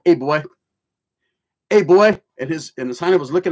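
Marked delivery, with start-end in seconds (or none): 0.0-2.2 imitating the guard. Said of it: "And I was like".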